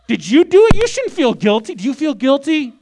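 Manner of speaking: a mock Southern interrogator, loud and high; Southern accent. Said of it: "that's a Southern a-, accent I did".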